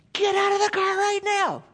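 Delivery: put-on a high, loud emergency command to the kids; urgent yell. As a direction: bark it like the car is in danger. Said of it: "I didn't yell at them unless there was an emergency".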